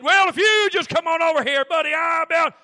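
loud, high yard shout; shouted threat. Retold or imitated. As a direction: bark a challenge. Retold holler in the yard.

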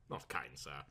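muttered. Acted. Butler to his guest, a low tired mutter. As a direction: mutter it under the breath, weary. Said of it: "he muttered".

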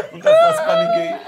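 high laughing squeal; laughing hard. Real, not acted. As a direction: burst out laughing through the words. Laughs at the rent call.

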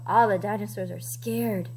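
usual speaking voice

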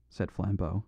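plain reading voice

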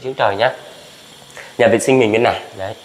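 ordinary delivery

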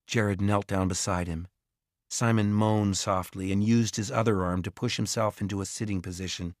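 ordinary delivery, nothing marked

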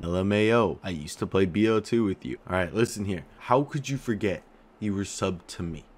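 ordinary talk, nothing marked

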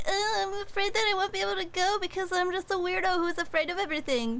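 mock him as a helpless weirdo; whiny scaredy-cat. Teasing her co-host by imitating his whiny fear. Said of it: "And he's still like".

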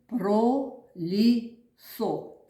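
very slow Пролісок, each syllable pulled apart. Shown as a demonstration. Teacher counting syllables aloud, very slow. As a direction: sound out Пролісок slowly. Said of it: "Три склади".

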